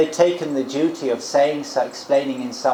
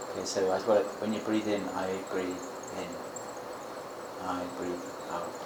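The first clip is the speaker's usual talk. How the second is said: guided meditation; 5 semitones lower, 11 dB quieter than usual. Shown as a demonstration. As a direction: guide the breath softly and unhurriedly. Leads a breathing meditation.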